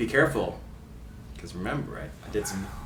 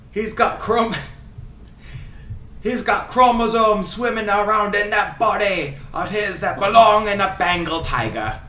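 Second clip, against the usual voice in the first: higher, theatrical fake Scottish accent; Ray Hudson Scottish accent; 5 semitones higher than usual. Host reading commentary quotes for guessing, in fake Scottish accent. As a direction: read it as a grand Scottish football commentator. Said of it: "doing his accent".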